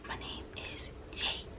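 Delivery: faint unvoiced whisper, slow. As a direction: breathe the name out like a shy student. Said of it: "'cause you're whispering".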